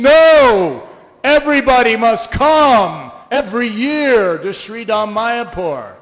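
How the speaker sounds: a loud, forceful no with insistence; the guru overruling the plan, loudly; emphatic no. Retold or imitated. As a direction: shout down the objection. Re-voicing Prabhupada's emphatic answer.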